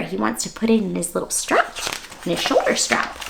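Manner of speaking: usual speaking voice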